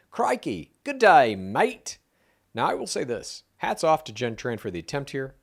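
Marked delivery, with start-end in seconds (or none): 0.1-2.0 hammed-up, high-pitched Australian accent; Jen's Australian accent. Host quoting Jen's attempt, hammed-up Australian accent. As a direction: put on your best mock Aussie accent. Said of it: "in her best Australian accent".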